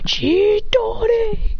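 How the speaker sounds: very high, stretched-out attack cry; mock anime battle cry; attack call. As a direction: drag the move's name out high. Character calls out attack.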